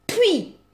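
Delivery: sharp high spitting sound; a scornful "pah!"; contemptuous spit. Acted. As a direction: spit at him in disgust. Spitting at groveling ex.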